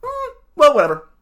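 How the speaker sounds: high, loud mock shrug; mocking dismissive voice. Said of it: "I go".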